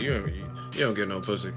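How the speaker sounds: ordinary talk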